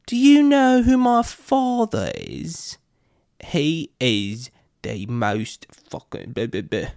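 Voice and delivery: put-on high, slow snobby rich kid; drunken posh sneer. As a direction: sneer it like a spoiled heir. Rich kid voiced mocking.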